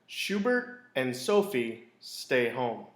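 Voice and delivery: ordinary delivery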